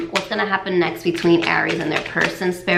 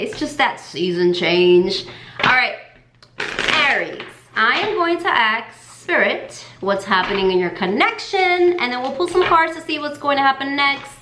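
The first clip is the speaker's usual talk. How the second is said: normal talking voice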